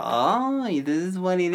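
high, swooping mock oh; mock realization. Correcting the impression to tease co-host, mock realization. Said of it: "he did more like that".

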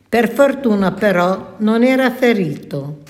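ordinary delivery

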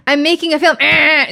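hammed-up Q&A statement; mock audience member. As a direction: act out a rambling guest who won't stop.

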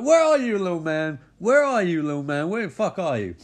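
high, loud taunting call into the dark; hollering at an unseen intruder; mock yell. [name] shouting through the house at the tiny man.

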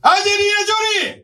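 high excited shout; shouted prompt. Real, not acted. (高い声で叫ぶ)

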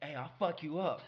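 high, loud retold challenge; mock drunk threat. Drunk fan to random frat boys, mock threat. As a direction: pick a fight, half-laughing.

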